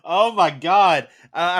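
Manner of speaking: loud, slow, stretched-out gasp of dismay; a loud drawn-out oh my God; flustered exclamation. Real, not acted. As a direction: blurt it out, caught off guard. Dreading the Hawke impression.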